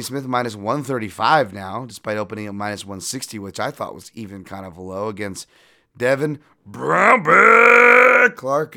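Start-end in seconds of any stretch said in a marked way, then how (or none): 6.0-8.8 drawn-out ring announcer shout, much higher and louder; announcer yell. Acted. Podcaster hyping the fighter, slow announcer bellow. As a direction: belt the name like a ring announcer.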